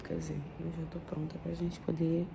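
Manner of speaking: very soft, hushed, tender good morning. Real, not acted. Whispering good morning beside her sleeping partner, gently hushed. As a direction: murmur so the sleeper isn't startled.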